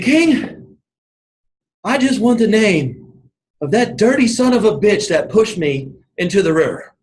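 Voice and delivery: high, exasperated gasping outburst, acted; imitating winded swimmer. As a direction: build from winded plea to indignant punchline. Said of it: "he's out of breath, and he just can't breathe".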